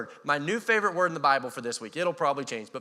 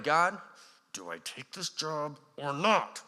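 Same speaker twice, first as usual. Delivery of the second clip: slow, drawn-out uncertain prayer; hesitant prayer; slower than usual. Retold or imitated. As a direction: ask God slowly, unsure. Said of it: "where you're praying".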